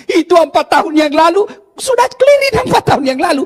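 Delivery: put-on wife reopening an old quarrel in a high, loud voice; nagging wife. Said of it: "dia bilang".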